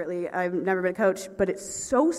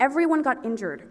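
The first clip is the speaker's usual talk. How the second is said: ordinary talk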